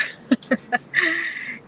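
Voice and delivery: burst of laughter. Real, not acted. Laughs at own admission.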